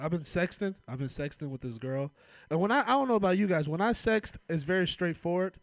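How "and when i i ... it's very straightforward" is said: excited build. Real, not acted. Excited sexting bit.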